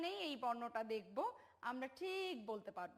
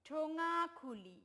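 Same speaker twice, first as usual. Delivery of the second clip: put-on slow, spaced ঠোঙা খুলি for children to repeat; teacher leading a choral read; 4 semitones higher, 3 dB louder, slower than usual. Shown as a demonstration. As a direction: lead the class slowly through ঠোঙা খুলি. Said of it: "আমরা একসাথে বলি সবাই".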